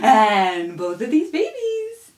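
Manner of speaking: lively singsong storybook voice; reading to children. Acted. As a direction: read it brightly to a little one. Reads a rhyme to a baby.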